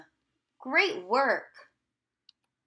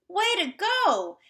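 excited praise; 9 semitones higher than usual. Real, not acted. Teacher praising the class.

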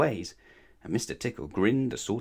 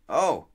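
high, louder startled "oh"; squeak; 6 semitones higher, 5 dB louder than usual. A narrator's reading. Mister Grumpy tickled in the cake shop, squeaking. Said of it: "squeaked Mister Grumpy".

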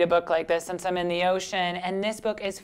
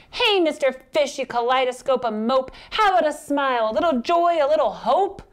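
high, loud, lilting squid voice; pert squid; 7 semitones higher, 5 dB louder than usual. Acted. Squid nags moping fish to smile, high and insistent. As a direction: push the fish to cheer up, pitched high.

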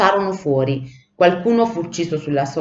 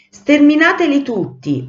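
high-pitched, forceful command; thundering order; 8 semitones higher, 4 dB louder than usual. A narrator's reading. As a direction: order the troops like a storm cloud. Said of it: "ordinò il nuvolone con un rombo". (ordine tonante e acuto)

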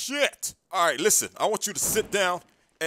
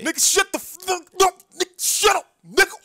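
much higher, louder, furious cut-off; high yelling outburst; angry shout; 13 semitones higher, 6 dB louder than usual. Acted. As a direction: shout him down in disbelief. Interrogator cuts him off.

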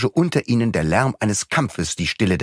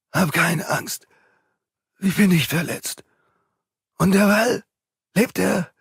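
hoarse whisper; 11 semitones higher than usual. Acted. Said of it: "Mit heiserer Stimme flüsterte er".

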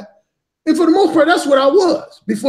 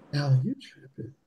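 much lower and much softer, meek; soft feminine voice; 8 semitones lower, 11 dB quieter than usual. Retold or imitated. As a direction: back down gently, almost murmured. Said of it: "her whole voice was real soft".

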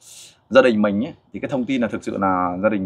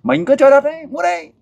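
excited urging; 4 dB louder than usual. Real, not acted. Urging the boy to show martial arts.

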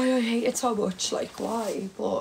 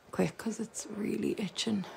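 urgent whisper; 8 dB quieter than usual. Real, not acted. Itchy eye, urgent aside.

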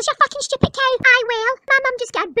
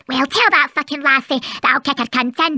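deep, growling Scottish mum; gruff low mother voice; 11 semitones lower than usual. Acted.

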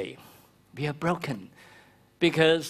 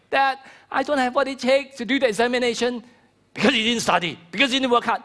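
mock parent scolding; 7 semitones higher, faster than usual. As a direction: scold the child, rising to a shout. Harsh parent scolding fearful kid.

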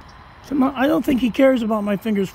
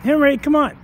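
louder, higher call to the dog; raised voice urging the dog on; 6 semitones higher, 5 dB louder than usual. Real, not acted. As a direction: call him over from a distance. Calling the dog from afar.

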